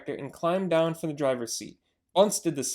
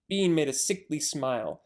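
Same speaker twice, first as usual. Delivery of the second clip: ordinary delivery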